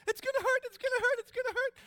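high, loud panicked scream; frightened daughter seeing the tweezers; panic mode. Retold or imitated. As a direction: shriek the phrase over and over. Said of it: "goes back into her panic mode".

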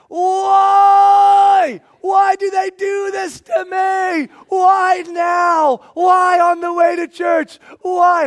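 very high, loud, slow wail of why; anguished shout. Retold or imitated. Pastor wailing at his house over the kid, hammed-up shout. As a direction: cry out to the heavens in mock despair. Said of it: "I'm like".

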